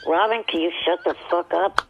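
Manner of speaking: ordinary talk, nothing marked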